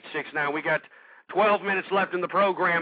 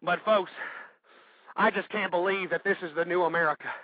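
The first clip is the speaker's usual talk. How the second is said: normal talking voice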